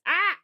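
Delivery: high loud hiccup yelp; sharp involuntary hiccup bark. Real, not acted. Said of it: "barking in your ears".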